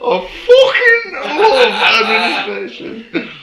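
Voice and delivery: very high, slow, loud cry of love; mock admin enthusiast. Co-host echoing the admin lover, hammed-up rapture. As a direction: wail it with laughing, exaggerated passion.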